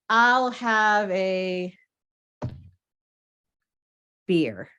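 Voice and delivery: drawn-out "I'll have a", long silence, then "beer"; big pause before "beer". Acted. Polar bear ordering from the bartender, huge pause before "beer". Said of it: "What's with the big pause?".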